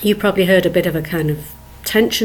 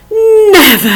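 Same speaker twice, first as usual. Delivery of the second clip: high, loud, defiant never; playful defiant never; emphatic never; 9 semitones higher, 5 dB louder than usual. Shown as a demonstration. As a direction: refuse outright. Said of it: "here's a couple more".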